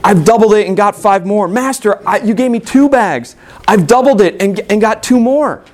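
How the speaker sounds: high, eager reporting to the master; excited servants showing off their doubled gold. Acted. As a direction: burst with excitement, voice lifted. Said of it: "you can almost hear the excitement in their words".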